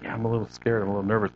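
softly voiced private worry; inner self-talk. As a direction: say it under your breath, as if only thinking it. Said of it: "you could just say inside".

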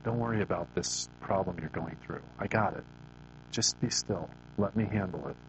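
soft reassurance. Retold or imitated. The Holy Spirit comforting a worried listener, softly. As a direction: hush the worry away kindly. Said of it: "whispers in your ear".

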